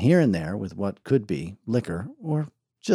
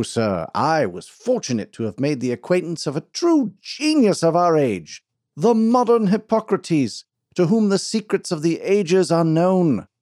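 pompous snake-oil salesman; 7 semitones higher, 4 dB louder than usual. Acted. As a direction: sell the crowd a tall tale like a showman.

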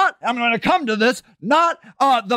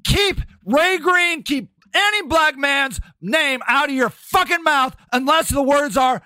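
ordinary talk, nothing marked

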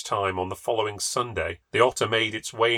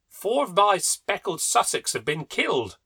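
exclaimed; 9 semitones higher than usual. A narrator's reading. Husband bursting into the breakfast room, a high exclaiming cry. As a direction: burst in with the bad news. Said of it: "he exclaimed".

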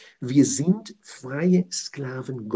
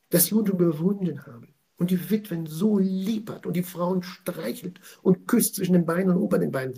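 ordinary talk

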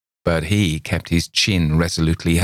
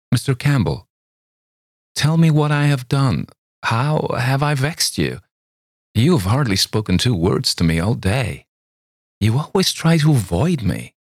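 put-on higher, coaxing young woman; young woman's pleading voice; 7 semitones higher than usual. A narrator's reading. Girl asks Campbell why he avoids her, high and pleading. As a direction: plead sweetly as the hurt girl.

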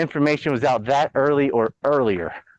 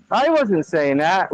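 slow, high whiny I wasn't saying that; mock denial; 6 semitones higher, 3 dB louder, slower than usual. Host imitating Fauci denying what's on tape. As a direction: play the caught liar, whining.

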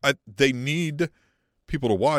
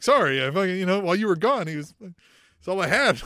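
higher, louder pleading apology; mock wounded self-justification; Paul Heyman, sad excuse; 5 semitones higher, 6 dB louder than usual. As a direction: plead your case like a jilted partner. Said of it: "he's, like, sad".

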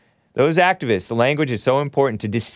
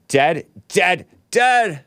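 "dead" punched, each one higher; emphatic repetition; 6 semitones higher, 3 dB louder, much slower than usual. Real, not acted. Host bluntly telling viewers he died, emphatic escalating "dead". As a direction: hit every "dead" harder, rising in pitch.

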